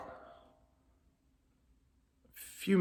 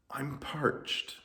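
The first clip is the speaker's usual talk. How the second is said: put-on soft, higher old gentleman; elderly gentleman; 4 semitones higher, 7 dB quieter than usual. A narrator's reading. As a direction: complain politely as an old man. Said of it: "one elderly gentleman who coughed politely".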